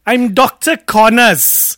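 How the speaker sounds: slow, loud mock Welsh accent. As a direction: put on a hammy Welsh lilt. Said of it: "Welsh accent".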